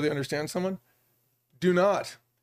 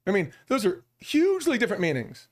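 ordinary talk, nothing marked

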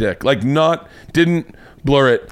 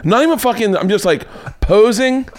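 excited disbelief; 9 semitones higher than usual. Retold or imitated. Incredulous retelling of posing.

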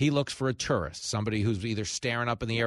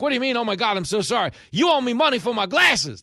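high, loud back-and-forth quarrel; loud mock quarrel; flustered tourist and grifter; 10 semitones higher, 6 dB louder than usual. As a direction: play both sides of a street scam. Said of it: "And you're like".

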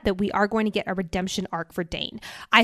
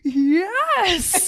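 high, drawn-out triumphant yes; retold thrilled cheer, pitched way up; excited; 9 semitones higher, 4 dB louder than usual. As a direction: cheer it like a fist pump in the air. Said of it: "I was so excited".

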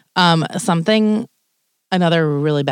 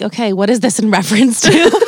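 mock panicky; 4 semitones higher, 5 dB louder than usual. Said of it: "And I was like".

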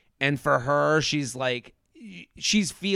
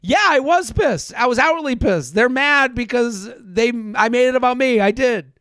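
fired-up confession; 5 semitones higher than usual. Retold or imitated. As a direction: say it proud and defiant, pitch up. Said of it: "when I saw Charity get the".

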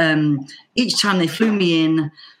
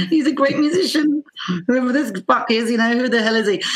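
astonished; 7 semitones higher than usual. Retold or imitated. Amazed inner reaction to playing.

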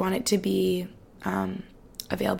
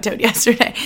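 laughing; 5 dB louder than usual. Real, not acted. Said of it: "said laughing".

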